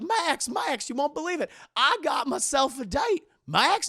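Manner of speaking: high, loud cartoon-dad calling out; excited Goofy. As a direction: burst in bragging to his son. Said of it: "he's so excited".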